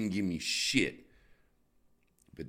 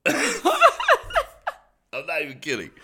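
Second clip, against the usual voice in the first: laughing; 9 dB louder, much slower than usual. Real, not acted. Laughing through line.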